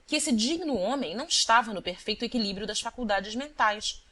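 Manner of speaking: plain reading voice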